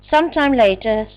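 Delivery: ordinary delivery, nothing marked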